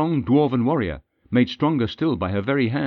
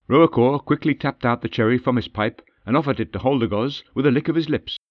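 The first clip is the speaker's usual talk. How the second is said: usual speaking voice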